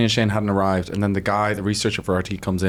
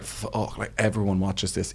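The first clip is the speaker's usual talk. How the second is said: much softer, as if thinking aloud; a nervous aside under the breath; hushed dread; 2 semitones lower, 8 dB quieter than usual. Retold or imitated. Retelling private nerves behind the curtain.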